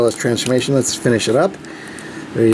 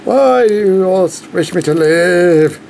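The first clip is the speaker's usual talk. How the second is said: pompous alien warning; 5 semitones higher, slower than usual. Pretend. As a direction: grandly warn the child of doom. Tobias voice bit again.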